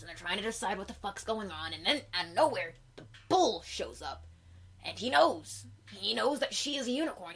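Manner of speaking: ordinary talk, nothing marked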